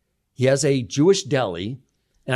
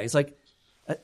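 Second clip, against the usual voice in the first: normal talking voice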